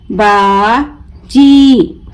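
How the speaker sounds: बाजी very slow, "baa", pause, long "jee"; broken into syllables. Shown as a demonstration. Teacher breaking the word for learners, बाजी stretched as baa... jee. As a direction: draw out बाजी as two separate syllables with a j. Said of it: "तोड़ तोड़ कर पढ़ूंगी".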